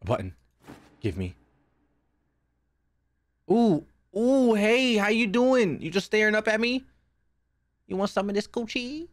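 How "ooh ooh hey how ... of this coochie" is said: mock flirting. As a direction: coo at it in a much higher voice. Flirting nervously with game figure.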